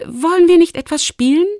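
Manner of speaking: put-on high, loud young invitation; eager suggestion. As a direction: propose a game in a lifted voice. Lumi proposes a game. (hoher, lauter, auffordernder Vorschlag)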